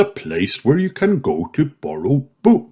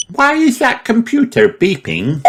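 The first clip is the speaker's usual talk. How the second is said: much higher piglet voice; Peppa asking; 13 semitones higher than usual. A narrator's reading. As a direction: wonder aloud like a small child. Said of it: "asks Peppa".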